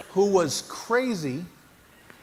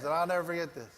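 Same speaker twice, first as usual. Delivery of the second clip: ordinary delivery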